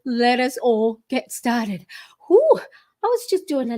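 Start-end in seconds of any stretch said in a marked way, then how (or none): 2.3-2.6 puffing exhale after dancing; breathless whew. Real, not acted. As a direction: blow out a winded whew. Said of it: "I'm already out of breath".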